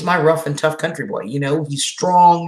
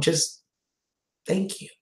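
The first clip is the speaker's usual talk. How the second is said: choked up; 8 dB quieter, slower than usual. Real, not acted. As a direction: voice fading to almost nothing, emotional. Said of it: "I'm gonna get all emotional again".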